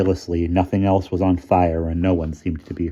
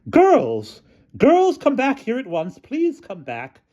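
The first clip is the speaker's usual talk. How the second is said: high, louder calls, fading to pleading; screaming at her girls; 4 dB louder, slower than usual. A narrator's reading. Miss Scrimmage calling her girls from the balcony, high and shrill. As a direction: call them back at once. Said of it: "stood screaming at her girls".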